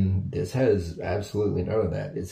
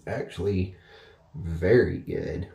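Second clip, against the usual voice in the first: talking around a cigar; cigar at lips. Real, not acted. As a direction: lips partly closed on cigar. Seen 0: Cigar held at lips during speech from 2.8s, then puffed.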